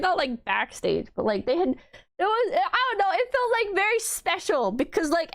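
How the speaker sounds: usual speaking voice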